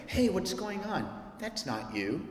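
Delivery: soft, tender correction; gentle parent. Retold or imitated. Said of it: "said that in a parenting moment".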